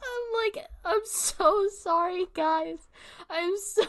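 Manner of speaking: high, drawn-out laughing plea; giggly apology. Real, not acted. Streamer saying sorry to viewers, helplessly amused. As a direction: apologise while losing it to laughter.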